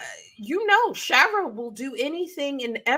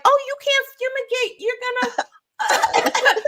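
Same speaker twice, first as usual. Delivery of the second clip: much higher, whiny mock protest; mocking objector; 14 semitones higher, faster than usual. Mocking whoever says she can't fumigate, high whiny voice. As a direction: squeak out a silly objection. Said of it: "Be like".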